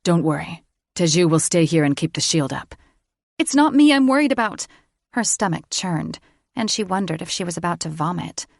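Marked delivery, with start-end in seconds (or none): 0.0-2.7 reassuring male voice. A narrator's reading. Thaddeus reassures. 3.4-4.7 frightened. A narrator's reading. Heroine frightened.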